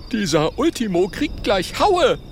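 high, indignant little dragon; angry growl. Acted. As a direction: threaten with bravado. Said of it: "Oskar knurrt wütend".

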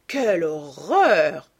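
very slow, drawn-out cry of horror, swelling loud; horrified cry. A narrator's reading. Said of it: "s'écria lord Henry". (cri d'horreur très étiré, qui enfle)

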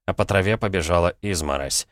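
ordinary delivery, nothing marked

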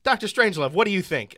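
a hammed-up nervous president's call; imitating the president. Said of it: "the president stands up and is like".

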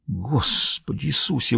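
slow, hushed breath of dismay; exhaled exclamation. A narrator's reading. Lennik reacting to Dietz's bad news, exhaled in hushed dismay. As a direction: let it out softly and slowly, like a sigh. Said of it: "выдохнул Ленник".

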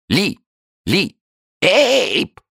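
high yelping imitation of names; creature mimicry. A narrator's reading. Newts bark back the names, high yelps. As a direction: yap the names like an animal.

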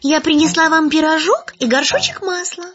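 put-on high, bright little girl voice. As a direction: speak up like a small girl. Girl answers at the door.

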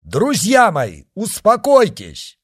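loud, high, slow call to a crowd. A narrator's reading. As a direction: shout to calm the people below. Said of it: "крикнул он". (громкий призыв к толпе)

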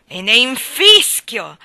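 loud, slow, heavy growl; gruff irritation. A narrator's reading. Miller gruffly dismissing Wakem's threat. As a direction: drag it out with contempt. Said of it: "con voce grossa e irritata".

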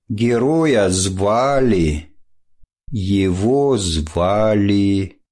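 very slow, dragging out each word; hesitant drawl. Acted. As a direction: stall for time, drawn out. Stalling student at the blackboard.

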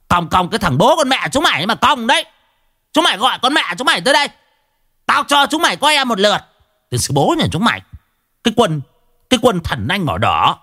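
high-pitched ranting yell, stamping feet; choa choa yelling. Acted. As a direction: shout it out, pointing and furious. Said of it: "chỉ tay ra mà choa choa". (quát tháo choa choa, giọng cao)

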